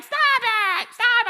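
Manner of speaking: high, loud mock yell; annoyed little sister. Sister on the couch to roaring brother, annoyed yell. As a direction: screech it at a pestering brother.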